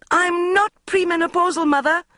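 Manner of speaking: high, loud indignant outburst; exploding. A narrator's reading. Bridget snapping at her mother, high loud outburst. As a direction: burst out, outraged. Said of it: "I exploded".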